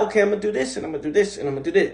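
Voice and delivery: rapid mock inner voice. Mocking a fragmented to-do mindset.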